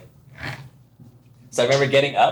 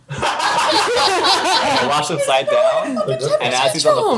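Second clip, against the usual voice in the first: words stretched slowly through laughter; laughing; much slower than usual. Real, not acted. Telling friends her reaction, laughing hard.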